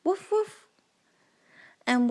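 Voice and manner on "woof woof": high, playful puppy bark; woof woof. Acted. Playing the puppy sound for the toddler.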